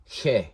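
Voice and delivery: "he" as a throaty hyeh; "he" as guttural heh; guttural Greek sound. Shown as a demonstration. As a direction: say "he" from the throat, rhyming with yeah. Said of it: "they didn't have the guttural".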